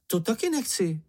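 high-pitched cry of refusal. A narrator's reading. Čenich refuses to walk first, crying out. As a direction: object in a high little voice. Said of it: "vykřikl Čenich".

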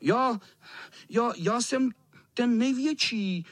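put-on high, halting little-boy voice; lightly shouted child's boast; small boy shouting. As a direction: stammer bravely up high. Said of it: "křičel malý".

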